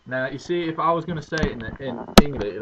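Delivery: ordinary talk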